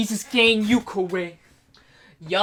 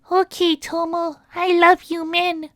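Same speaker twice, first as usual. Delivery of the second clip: high voice; 10 semitones higher than usual. Acted. High meme voice.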